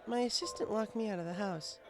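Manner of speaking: put-on high, bookish young mare voice, caught off guard; Twilight Sparkle. Twilight puzzled the bartender knows her name, high voice. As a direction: pitch it up as a studious unicorn, puzzled. Twilight explains why she came.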